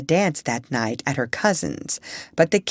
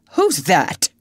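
short, fairly loud startled demand; wide awake, startled; 4 dB louder than usual. A narrator's reading. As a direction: demand it like someone jolted awake. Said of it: "sat up wide awake and said".

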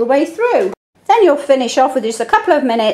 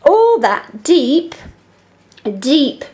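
slow reading; much slower than usual. Shown as a demonstration. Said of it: "Did you hear an E?".

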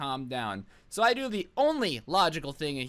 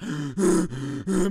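a loud, breathless gasp after running; imitated heavy gasp; 4 dB louder than usual. Mimicking the winded kid who charged him, heavy gasp.

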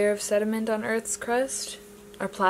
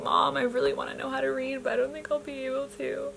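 put-on little girl self; 6 semitones higher than usual. First grader telling mom she can't learn to read, small pleading voice. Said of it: "I was like".

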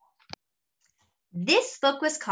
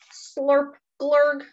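higher mock gulping slurp; slurp noise; 6 semitones higher, 3 dB louder than usual. Fred drinking swamp water, mock slurp and gulp. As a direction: make a silly drinking slurp. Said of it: "And he goes".

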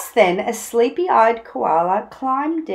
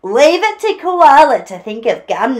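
high, loud appreciative remark; lightly laughing dingo; amused Dingo; 4 semitones higher, 8 dB louder than usual. A narrator's reading. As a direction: chuckle in tone at Koala's idea. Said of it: "Dingo laughed".

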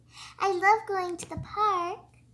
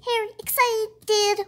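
ordinary delivery

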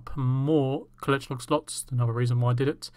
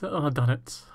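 ordinary talk

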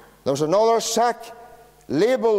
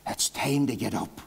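soft wake-up call; 6 semitones lower, 7 dB quieter than usual. Retold or imitated. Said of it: "the voice saying".

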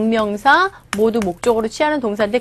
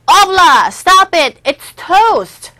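louder, higher stern alien king; stern king command; 8 semitones higher, 7 dB louder, much slower than usual. Acted. Alien king scolds Obla in a loud, high command. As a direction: order Obla to stop, sharply.

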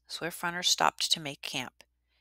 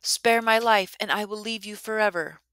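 high, loud desperate plea; the defeated bear begging the boy, high and loud; pleading; 6 semitones higher, 7 dB louder than usual. Acted. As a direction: beg for its life. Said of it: "it pleaded".

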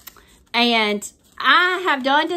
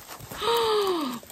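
2 high gasp of surprise; 3 gasp at the reveal; 4 surprised happy gasp; 1 delighted gasp; 8 semitones higher, 4 dB quieter than usual. Real, not acted. Seeing gift.

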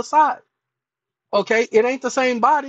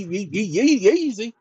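ordinary talk

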